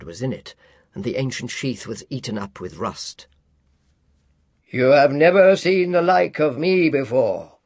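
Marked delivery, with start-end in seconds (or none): 4.7-7.6 louder, resonant spirit voice; echoing ethereal energy being. A narrator's reading. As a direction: announce yourself as a giant spirit. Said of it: "exclaimed the spirit".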